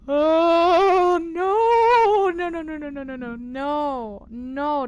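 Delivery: high, loud panicked cry of no, slowing at the end; freaked-out no's. Real, not acted. Player spooked by an eerie call log, panicked high no's. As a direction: recoil in genuine dread as the creepy log ends.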